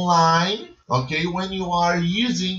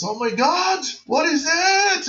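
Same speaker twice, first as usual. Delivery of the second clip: acted surprise; 11 semitones higher than usual. Shown as a demonstration. Teacher acting out surprise for the class. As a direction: sound amazed at something new. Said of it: "it's a surprise".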